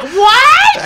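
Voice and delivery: very high, loud shriek of disbelief; mother freaking out. Retold or imitated. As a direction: imitate a mother shrieking at big news. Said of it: "Like, she freaked out".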